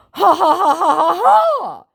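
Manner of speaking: high, loud fake laughter; loud mock "ha ha" at a high pitch; hammed-up scammer laugh. Scammer laughing off the claim, hammed-up fake laugh. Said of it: "came the response".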